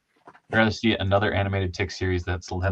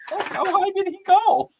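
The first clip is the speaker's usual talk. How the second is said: much higher astonished question; amazed reaction; 4 dB louder than usual. Retold or imitated. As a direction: gasp at how far he flew. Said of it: "it's like".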